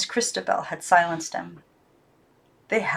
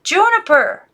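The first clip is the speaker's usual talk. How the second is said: high, loud desperate call; urgent call; 12 semitones higher, 8 dB louder than usual. Acted. Girl calls the silent dog, loud and desperate. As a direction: call him, desperate now. Calling again louder.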